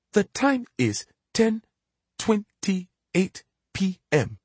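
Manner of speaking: slow talking clock announcement; robotic clock voice. Acted. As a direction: drag it out like a machine. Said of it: "a low robotic voice".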